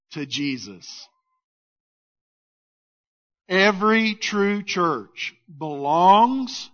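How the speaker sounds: ordinary talk, nothing marked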